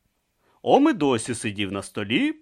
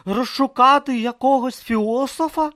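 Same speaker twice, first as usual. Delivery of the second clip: puzzled question; 7 dB louder than usual. Acted. Brutha asks Om.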